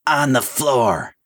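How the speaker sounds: slow, high-pitched order; mild mock scream; screamed command. A narrator's reading. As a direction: draw out the command. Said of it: "she screamed".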